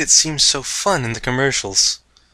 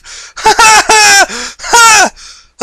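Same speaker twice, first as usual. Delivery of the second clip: high, loud, crazed laugh; wild laugh; 13 dB louder than usual. Acted. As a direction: cackle with wild joy. Maniacal laugh after revival.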